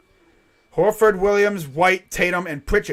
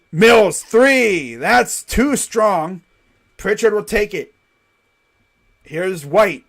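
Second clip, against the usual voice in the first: energetic basketball commentary; live play-by-play; 8 dB louder, slower than usual. Real, not acted. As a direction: follow the ball, building to the shot.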